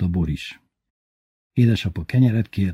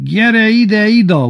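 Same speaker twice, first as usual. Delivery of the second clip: high, loud imitated drunken bellow; drunken yell; 14 semitones higher, 8 dB louder, slower than usual. Villager mocked for yelling to wife Ida, drunken yell. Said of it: "amikor részegen üvölti".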